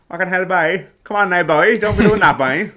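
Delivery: loud, high Cork accent. Shown as a demonstration. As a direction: shout it like a Cork lad. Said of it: "I can't do it".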